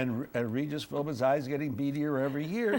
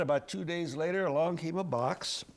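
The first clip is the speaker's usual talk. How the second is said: ordinary talk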